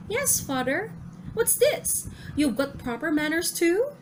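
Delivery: put-on high, eager child's voice; a little son answering his father, high-pitched; young boy.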